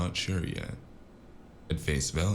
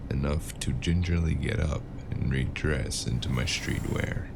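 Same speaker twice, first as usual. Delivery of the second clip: ordinary talk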